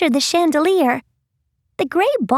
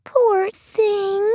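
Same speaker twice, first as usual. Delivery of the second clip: higher, lightly voiced sympathy; pitying Maddie; 5 semitones higher than usual. A narrator's reading. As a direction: lift the pitch with a touch of pity. Maddie pities the creature.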